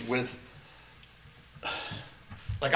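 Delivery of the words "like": ordinary talk, nothing marked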